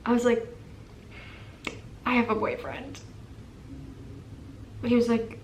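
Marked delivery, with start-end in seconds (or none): 2.1-3.0 unsure. Retold or imitated. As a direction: say it timidly, after a pause. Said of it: "more confidently that time".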